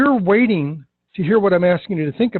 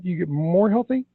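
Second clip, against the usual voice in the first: "more" held long before "healthy"; stretched MORE; 3 dB quieter than usual. Shown as a demonstration. Coach showing salespeople the script, "more" stretched out. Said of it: "I stretched it out".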